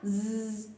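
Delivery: soft buzzing ज़ like a fly; quiet imitated fly buzz. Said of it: "ऐसे करके कहते हैं ना".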